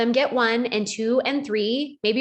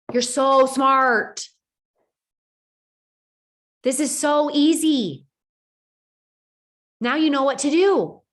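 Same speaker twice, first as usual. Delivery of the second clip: encouraging; 3 semitones higher, 3 dB louder, slower than usual. Shown as a demonstration. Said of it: "encouraging self-talk".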